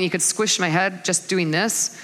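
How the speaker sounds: ordinary talk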